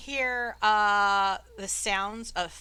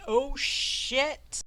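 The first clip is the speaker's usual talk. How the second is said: voiced bystander's drawn-out exclamation; mild acted alarm; exclaiming; 3 semitones higher than usual. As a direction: exclaim it as a startled onlooker. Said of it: "you hear some people exclaiming".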